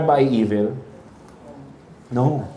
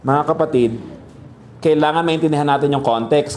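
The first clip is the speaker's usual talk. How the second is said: ordinary delivery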